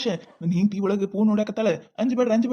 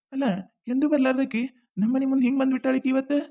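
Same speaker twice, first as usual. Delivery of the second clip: ordinary talk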